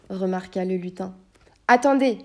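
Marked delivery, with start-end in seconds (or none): 1.7-2.2 sharp wait. Acted. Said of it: "fit le chevalier".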